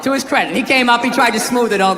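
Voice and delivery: ordinary delivery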